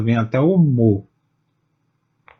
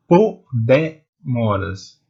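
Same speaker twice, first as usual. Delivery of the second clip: "Po, demoras" very slow, with gaps between beats; separated syllables; much slower than usual. Shown as a demonstration. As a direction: chop "Po, demoras" into distinct slow pieces. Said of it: "São separadas".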